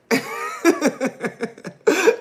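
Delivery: high real laugh she can't hold back; real anxious laughter; nervous laugh. Real, not acted. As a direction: let the nervous giggle out. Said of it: "but I'm laughing".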